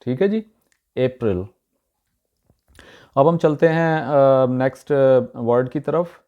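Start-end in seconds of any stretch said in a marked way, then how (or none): 1.0-1.5 अप्रैल starting with a long 'ay', short weak ending; correct pronunciation. Shown as a demonstration. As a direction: say अप्रैल as AY-pruhl, stress first. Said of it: "अप्रैल नहीं".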